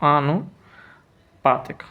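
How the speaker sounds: आनुपातिक slowly, pausing between आनु and पातिक; measured, gapped आनुपातिक; slow dictation. Shown as a demonstration. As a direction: split आनुपातिक in two for the writer. Teacher dictates spelling syllable by syllable.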